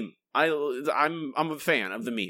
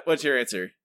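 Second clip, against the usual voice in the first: usual speaking voice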